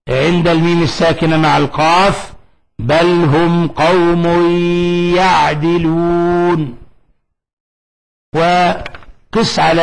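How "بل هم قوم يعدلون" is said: very slow chanted verse; slow recitation. Shown as a demonstration. Teacher recites the example verse, slow chant. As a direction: stretch the verse out calmly. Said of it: "وكذا في قوله تعالى".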